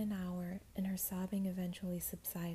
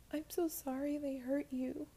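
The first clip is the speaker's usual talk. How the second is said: remorseful; 7 semitones higher than usual. Acted. Jess apologizing, distraught.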